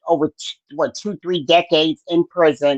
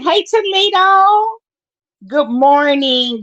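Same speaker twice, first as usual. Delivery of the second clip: drawn-out, much higher hello; excited greeting; 9 semitones higher, slower than usual. Real, not acted. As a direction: sing out the welcome slowly. Greeting live chat viewer.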